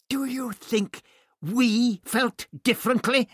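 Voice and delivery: high, loud, indignant retort; snapping. A narrator's reading. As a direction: snap back sharply, pitch shooting up. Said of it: "the doctor snapped".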